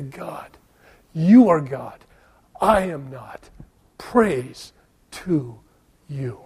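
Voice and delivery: hushed prayer. Acted. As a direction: pray it face down, hushed. Worshipper prays face down.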